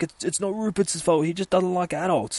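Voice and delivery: high, put-on child's voice; little boy voice. Little boy defending the spider from behind, high child voice. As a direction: imitate a small boy explaining. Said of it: "says the little fucking boy".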